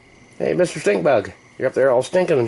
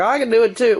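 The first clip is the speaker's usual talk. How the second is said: much higher, louder eager little bug voice; 12 semitones higher, 4 dB louder than usual. Pretend. Voicing the weird bug copying the stink bug, high and eager. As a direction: pipe up like a keen copycat bug. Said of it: "he's like".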